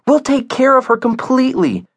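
put-on very high, earnest child voice; pleading child. Via persuading her mom to keep the dog, pleading child.

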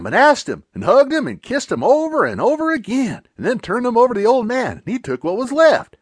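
ordinary delivery

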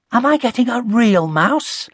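put-on high, hopeful child voice; perking up. A narrator's reading. Connie guessing at a pet mouse, perking up. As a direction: brighten with sudden hope. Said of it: "perking up".

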